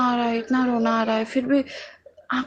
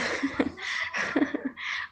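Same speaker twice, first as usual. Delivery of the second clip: breathy giggle; 4 semitones higher, 11 dB quieter than usual. Real, not acted. Laughing while telling the story.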